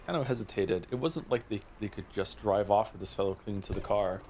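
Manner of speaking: usual speaking voice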